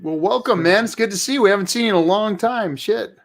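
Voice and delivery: usual speaking voice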